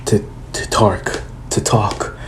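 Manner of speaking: hushed urgent whisper. Retold or imitated. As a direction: keep it secret and serious. Naruto asks Hokage seriously.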